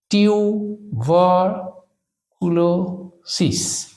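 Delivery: Tuberculosis very slowly, in separate chunks; long pauses inside টিউ-বার-কুলো-সিস; syllable by syllable. Shown as a demonstration. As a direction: dictate tuberculosis piece by piece. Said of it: "syllable ভাগ করে এটাকে যদি আমরা উচ্চারণ করি".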